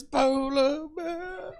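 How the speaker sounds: slow, reproachful polar bear like a nagging mother; guilt-tripping Attenborough. Retold or imitated. Comic comparing Attenborough to his guilt-tripping mother. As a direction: lay on the guilt, drawn out. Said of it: "like my mother says".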